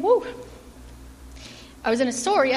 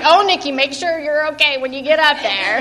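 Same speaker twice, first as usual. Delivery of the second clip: louder mock-worried mom, amused; amused mom imitation; 3 semitones higher, 7 dB louder than usual. Daughter retelling mom's reaction to her preaching, amused mock worry. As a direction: retell mom's fretting through laughter.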